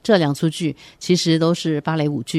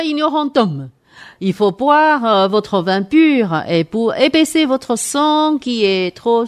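booming, higher pompous doctor; haughty aristocratic french; 5 semitones higher, 4 dB louder, slower than usual. Acted. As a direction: thunder the orders like a self-important physician. Doctor scolds patient.

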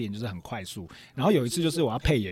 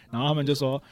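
normal talking voice

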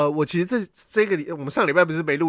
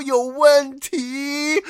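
stretched very slowly, syllable by syllable; slow-motion line; 7 semitones higher, much slower than usual. Retold or imitated. Facing the lion's open mouth in slow motion, drawn-out words. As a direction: drag it out as if time has nearly stopped.

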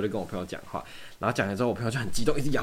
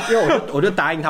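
laughing; 7 semitones higher, 8 dB louder, faster than usual. Real, not acted. Laughs telling the promise.

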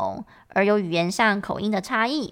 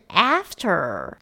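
American pronunciation; 3 semitones lower than usual. Shown as a demonstration. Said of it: "美式的呢，A比较像是蝴蝶音的A".

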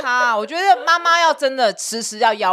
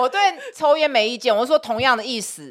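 ordinary delivery

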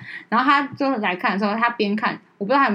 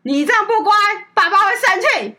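imitated yell; 9 semitones higher, 4 dB louder than usual. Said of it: "我哥直接在那边厨房哦，就说".

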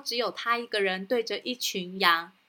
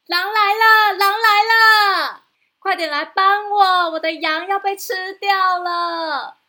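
much higher, loud panicked yelling; panicked shout; 9 semitones higher, 10 dB louder than usual. Acted. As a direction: cry out for help in a panic. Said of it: "然后再慌张地对着山下大叫". (惊慌大叫)

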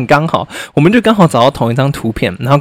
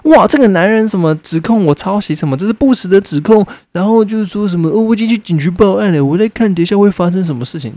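higher mock voice of the accuser; mocking imitation; 7 semitones higher than usual. Mocking Ms. H's public post, higher mock voice. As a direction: parody her indignant post, higher. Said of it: "那个动态里面就说".

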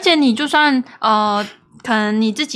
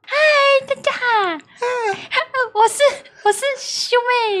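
put-on baby voice; 11 semitones higher than usual. Shown as a demonstration. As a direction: squeak it like a cute doll. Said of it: "不要有鼻音".

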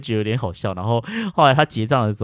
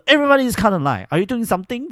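high, louder angry demand; fierce scolding; 12 semitones higher, 4 dB louder than usual. Retold or imitated. Confronting the auntie at the counter, high angry demand. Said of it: "我就整个超凶".

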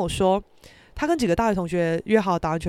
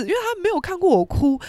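ordinary talk, nothing marked

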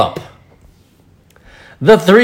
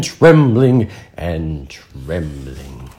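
slow, low and shaky; trembling voice; 8 semitones lower, much slower than usual. A narrator's reading. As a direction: tremble the words out slowly. Narrator acts out trembling wolves.

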